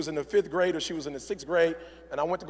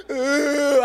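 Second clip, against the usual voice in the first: high mock wail; hammed-up wordless crying; imitated sob; 8 semitones higher, 4 dB louder than usual. Daughter unable to say his name, mock sobbing. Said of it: "my girl's crying".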